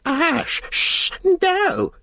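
thin hissing shriek, very high; frightened, angry cry. A narrator's reading. Gollum sees Sam's fire, frightened hissing shriek. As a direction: shriek and hiss in alarm as a creature. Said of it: "he cried".

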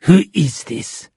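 slow, controlled anger; angry demand. A narrator's reading. Shark demanding who is on the phone, angrily. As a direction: demand it angrily, drawing out the words. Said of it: "the shark said angrily into the phone".

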